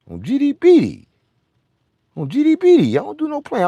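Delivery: clown it up in a squeaky voice; a goofy high-pitched taunt; high mock voice. Mocking voice riff.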